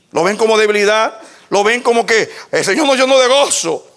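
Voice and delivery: insistent pulpit delivery; fervent preaching. Real, not acted. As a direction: press the congregation with impassioned questions.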